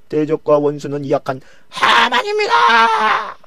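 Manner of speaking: high, slow, loud denunciation; accusing shout. Acted. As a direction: proclaim the enemy's name. Esther names the enemy.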